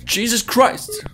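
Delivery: high, loud, drawn-out shout; startled shout. Real, not acted. Streamer hit by a jump scare, startled shout. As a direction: yell out in fright.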